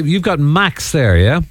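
ordinary delivery

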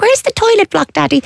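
put-on high little kid asking dad; squeaky child's plea. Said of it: "We were like".

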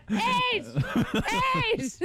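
high-pitched yell through laughter; very high comic shout; rowdy mock shout. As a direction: holler it, hammed-up and laughing. Acting rowdy later at night.

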